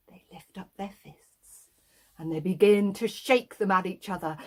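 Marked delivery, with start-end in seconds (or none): 0.1-1.7 hushed narration whisper. A narrator's reading. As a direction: drop to a whisper. Storyteller whispers suspense.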